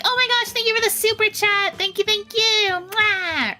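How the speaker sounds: cute VTuber thanks. Retold or imitated. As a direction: gush over the super chat like a cute VTuber. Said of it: "when you see VTubers be like".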